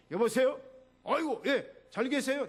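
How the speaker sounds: loud, high, hearty hello; energetic phone greeting. Acted. Pastor surprising a worried caller, loud and energetic. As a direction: answer the phone full of vigor. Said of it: "목사님 전화 들을 때마다 자기가 힘이 난대".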